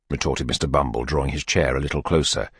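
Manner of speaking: ordinary delivery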